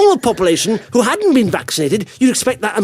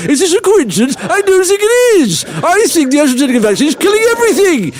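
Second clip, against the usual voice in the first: sketch character. Acted. Mock vaccine sceptic reporting dead pets to the public, increasingly hysterical. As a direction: build from measured testimony to a hammed-up conspiracy rant. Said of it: "this is a little sketch here".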